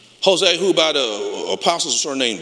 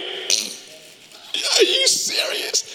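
very high, slow disbelief; incredulous bully; much slower than usual. Retold or imitated. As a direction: stretch it out high in mock disbelief. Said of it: "he's standing there like".

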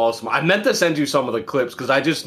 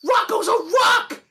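Elmo snapping. Retold or imitated. Friend retelling Elmo snapping at Zoe, very high shriek. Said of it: "And then Elmo goes".

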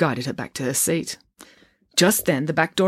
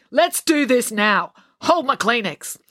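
put-on high tough girl voice; 7 semitones higher, slower than usual. April challenging fight.

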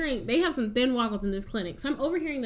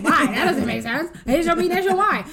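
loud, high, indignant why; hammed-up exasperated friend; mock outrage; 5 semitones higher, 7 dB louder than usual. Friend outraged at the clinic, shouting why.

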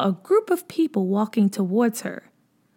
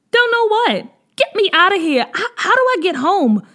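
higher, louder frightened demand; panicked; 10 semitones higher, 5 dB louder than usual. A narrator's reading. Lost girl demanding to go home, loud and panicked. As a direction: beg to be taken home in alarm.

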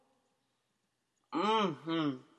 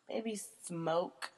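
unvoiced whisper, thinking it over; whispered musing; 6 dB quieter than usual. Real, not acted. Pondering which spice she'd be, whispered. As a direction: breathe the word while pondering. Thinking aloud about the question.

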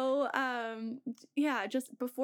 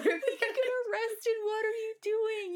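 high-pitched, laughing alarm; laughing mock scolding, up high; mock panic; 11 semitones higher, 3 dB louder than usual. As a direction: imitate yourselves hushing her in a fluster. Panicked hushing of wild friend.

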